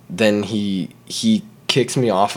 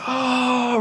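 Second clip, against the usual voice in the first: pained oh. Retold or imitated. Imitates the friend's groan.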